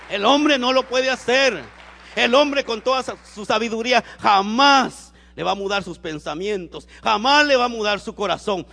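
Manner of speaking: loud, high-pitched preacher's declaration; full-force preaching. Real, not acted. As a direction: drive every phrase out forcefully to the congregation. (prédica a toda voz)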